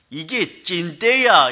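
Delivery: slow, drawn-out Gangwon dialect 이게 찐때야; 찐때야 stretched slowly in Gangneung dialect. Retold or imitated. Pastor imitating Gangneung locals, slow Gangwon dialect. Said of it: "이렇게 얘기해요".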